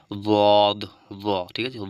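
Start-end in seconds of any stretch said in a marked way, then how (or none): 0.1-0.8 দদ said loud and drawn out from the tongue's side edge; Arabic letter dad. Shown as a demonstration. Teacher modelling দদ for students, loud and deliberate. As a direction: model দদ clearly for learners, tongue's side against the upper molars. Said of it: "তো উচ্চারণটা কেমন হবে?".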